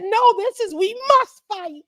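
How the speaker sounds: fired-up rallying cry. Retold or imitated. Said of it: "and I said".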